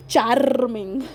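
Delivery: CHARMING in an Indian accent with a long rolled R. Shown as a demonstration. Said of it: "why am I talking in this Indian accent".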